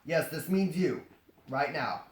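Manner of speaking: ordinary delivery, nothing marked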